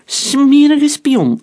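high, loud, slow shout; accusing shout. A narrator's reading. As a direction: hurl the accusation. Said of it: "riep een achtervolger".

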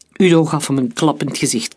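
ordinary delivery, nothing marked

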